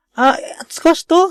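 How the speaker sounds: high, slow puzzled question; slow, high confused "squash to?"; puzzled surprise. Retold or imitated. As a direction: hold the word squash in disbelief. Puzzled at drink name.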